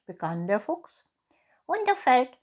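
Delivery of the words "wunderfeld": high, loud stage-whisper of a cat. Acted. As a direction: echo it in a high, secretive cat voice. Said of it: "flüsterte die Katze".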